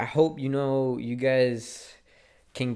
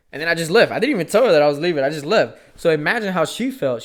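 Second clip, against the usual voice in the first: regretful outburst; 6 semitones higher, 11 dB louder, faster than usual. Real, not acted. Regretful outburst about leaving her.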